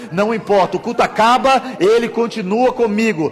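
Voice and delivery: high, loud declaration of faith; full-force preaching. Real, not acted. Preacher builds in intensity.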